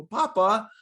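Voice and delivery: high, loud mock child's protest; imitated protesting grandkids. Grandkids objecting to grandpa's life talk, imitated protest. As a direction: voice a grandchild objecting, pitched well up.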